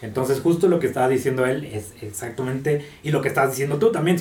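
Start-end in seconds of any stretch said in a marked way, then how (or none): none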